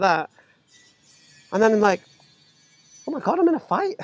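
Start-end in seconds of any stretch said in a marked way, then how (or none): 3.1-4.0 high, startled inner exclamation; sudden realisation. Retold or imitated. Man realising mid-punch he's in a fight, high startled exclamation. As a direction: gasp it out as it dawns on you.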